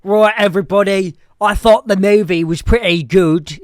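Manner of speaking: loud Scottish reviewer ranting; Critical Drinker impression. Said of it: "This is my Critical Drinker impression".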